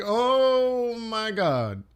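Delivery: soft, slow, stunned oh my God. Retold or imitated. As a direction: drag it out quietly in disbelief. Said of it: "You're like".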